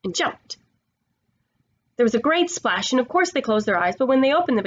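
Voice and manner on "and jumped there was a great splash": plain reading voice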